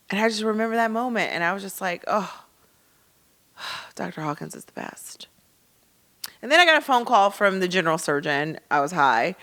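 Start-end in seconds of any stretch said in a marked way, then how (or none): 2.1-5.3 relieved sigh. Retold or imitated. Relieved after good news.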